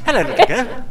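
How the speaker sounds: laughing creepy man. Acted.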